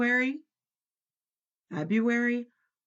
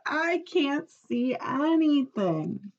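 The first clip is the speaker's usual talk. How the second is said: dazzled Toad; 7 semitones higher, slower than usual. A narrator's reading. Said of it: "said Toad".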